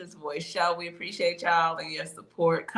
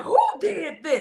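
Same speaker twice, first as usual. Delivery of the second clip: mock outrage; 10 semitones higher, 4 dB louder than usual. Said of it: "He went out to the pool and said".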